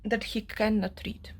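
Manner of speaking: ordinary talk, nothing marked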